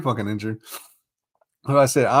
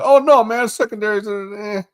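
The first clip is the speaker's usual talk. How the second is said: mock the doubters in a much higher voice; mocking imitation; 10 semitones higher than usual. Host parroting people who dismissed the secondary worries, high mocking voice. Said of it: "A lot of people were like".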